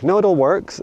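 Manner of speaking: high breezy dismissive voice; mock customer service. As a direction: brush it off like a cheery provider. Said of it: "the providers were like".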